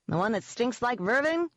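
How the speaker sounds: high, carrying call. A narrator's reading. Jenks answering Ivy from afar, calling. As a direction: call across the room. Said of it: "he called".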